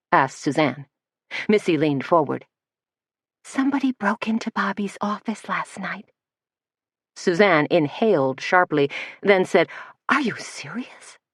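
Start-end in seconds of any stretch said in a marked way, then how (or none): 3.4-6.1 softer, hushed confiding; dropped voice. Acted. Missy confiding in Suzanne in a busy cafe, hushed and softer. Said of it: "dropping her voice". 10.1-11.2 stunned breathless whisper; shocked whisper. Acted. Suzanne reacting to Missy's news, shocked whisper.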